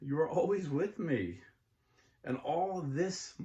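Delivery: slow, hushed Bible reading; scripture reading. Acted. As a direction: read it like a gospel passage. Reads the prodigal son parable.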